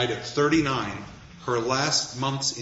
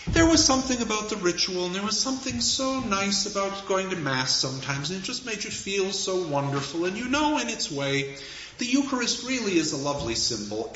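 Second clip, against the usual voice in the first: high, airy, mock-pompous musing; pontificating; 8 semitones higher than usual. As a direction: hold forth in a lofty, high-pitched voice. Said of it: "is pontificating about".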